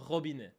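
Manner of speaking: robinet with a quick uvular French R. Shown as a demonstration. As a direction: say robinet with a short R from the throat. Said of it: "That's it".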